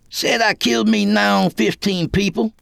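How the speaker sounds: high, scornful snort of a reply. A narrator's reading. Kid telling what he is accused of, snorted. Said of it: "he snorted".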